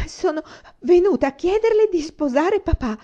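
high, loud, blurted and nervous; a frightened child forcing the words out; stammering plea. Acted. Una begs Rosemary to marry her father, stammering. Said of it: "balbettò".

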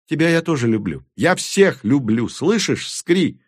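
выше и громче; делиться счастьем со всеми; радостно, взахлёб. A narrator's reading. Тури радостно хохочет. (Тури на шее у чародея, радостно)

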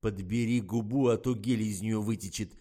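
loud snapped order; gruff, forceful telling-off; barked rebuke. A narrator's reading. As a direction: bark it at the actress. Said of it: "рявкнул Фомин".

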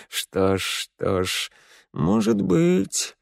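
soft musing king voice; thoughtful king. A narrator's reading. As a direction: ponder it quietly. King pondering. (задумчивый король)

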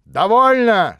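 sharp cutoff. Acted. Prince silencing Natasha, sharp loud command. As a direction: slam the word down. Prince cuts the scene short.

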